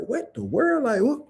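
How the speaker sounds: put-on high puzzled kid voice, rising on each what; confused child. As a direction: play a baffled little kid hearing something strange. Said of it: "the child gonna be like".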